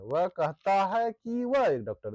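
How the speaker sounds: ordinary delivery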